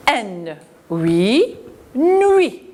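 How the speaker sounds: teacher sounds out N huit, nuit; blending into nuit. Shown as a demonstration. Teacher to children, blending N huit into nuit. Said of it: "On a la lettre muette".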